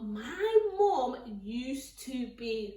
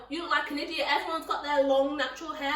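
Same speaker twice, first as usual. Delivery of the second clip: ordinary talk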